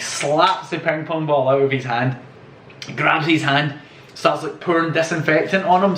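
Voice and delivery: ordinary talk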